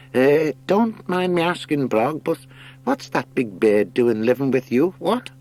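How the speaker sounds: put-on high, fussy old hare voice; nervous old hare. Old hare nervously asks about the heron, high fussy voice. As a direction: ask timidly, eyeing the heron. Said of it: "moved nervously away".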